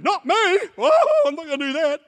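high, loud whiny refusal; mock refusal. Preacher mocks believers refusing God's call, high whiny no. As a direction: whine like a scared believer dodging God.

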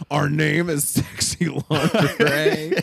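slow, drawn-out proud boast; proud announcement. Retold or imitated. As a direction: announce it slowly with pride. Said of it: "Joe's like".

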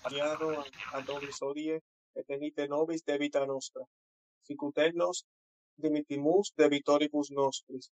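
devout recited response in Latin; Latin prayer. Real, not acted. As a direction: answer the prayer, steady and reverent. Answers the Latin Our Father.

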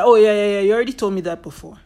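loud, bored oh yeah yeah, then rushing fast; mock impatient listener cutting in; dismissive brush-off. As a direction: wave them off impatiently. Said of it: "you'll be like".

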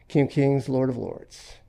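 ordinary talk

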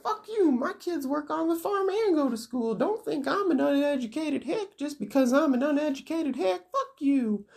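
put-on very high caricatured old lady; indignant old woman. Old woman snaps at school kids, high mock indignation. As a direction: scold in a squeaky put-on crone voice. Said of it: "And she's like".

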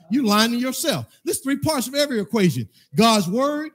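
loud, insistent preacher's build; full-force preaching. Acted. Pastor correcting the congregation's excuses, loud insistent preaching. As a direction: hammer each point to the church.

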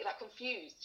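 ordinary delivery

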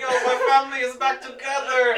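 high, loud, laughing mock gush; mocking the movie's family reunion line; mock sappy reunion. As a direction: gush it like a cheesy hero, hammed up. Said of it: "they're like".

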